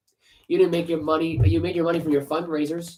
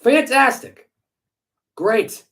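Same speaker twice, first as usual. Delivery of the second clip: higher, louder mock enthusiasm; sarcastic; 6 semitones higher, 4 dB louder, much slower than usual. Real, not acted. Host reacting to Patriots coaching news, sarcastic. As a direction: fake cheer dripping with sarcasm.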